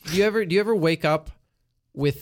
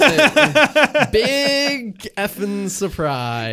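sarcastic; 6 semitones higher, 7 dB louder, much slower than usual. Acted. Sarcastic about Iceland again.

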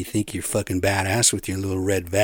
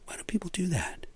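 high, soft whispered aside; hushed, whispery bafflement; baffled whisper; 9 semitones higher, 9 dB quieter than usual. Real, not acted. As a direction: whisper it as a puzzled question. Baffled aside about odd names.

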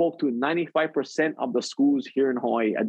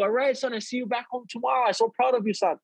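higher, fond mom voice; lightly voiced mother, pitched up; proud mom; 8 semitones higher than usual. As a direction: mom praising her son on the phone.

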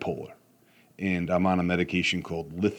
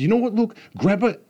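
raised, exasperated order to his son; frustrated; 13 semitones higher, 4 dB louder, faster than usual. Retold or imitated. Dad losing patience with son on the ladder, raised frustrated voice. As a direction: snap at the kid to grab it, voice high. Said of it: "I was very frustrated".

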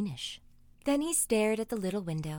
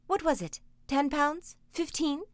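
eager; 6 semitones higher than usual. A narrator's reading. Mother eager guesses.

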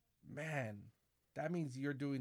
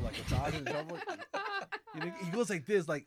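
ordinary delivery

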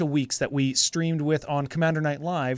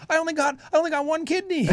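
high mock pitiful voice; 13 semitones higher, faster than usual. Joke about selling kidney.

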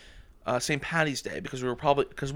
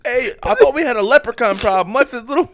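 high, loud comic yelling; mock outraged shout; 10 semitones higher, 12 dB louder than usual. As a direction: play indignant while laughing. Acts out angry film character.

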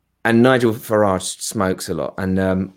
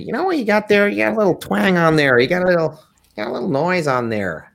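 Jerry Nelson; 9 semitones higher, faster than usual. Acted. Actor quoting a friend admiring his guitar, high impression. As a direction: pitch it way up, then let it sink.

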